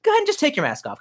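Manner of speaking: high-pitched incredulous jab; hammed-up high accusation; mock accusation. Acted confrontation of the man.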